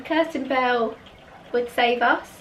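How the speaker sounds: usual speaking voice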